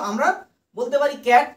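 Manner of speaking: normal talking voice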